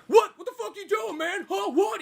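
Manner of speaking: high-pitched agitated challenge; mock a worked-up man confronting you; imitating angry driver.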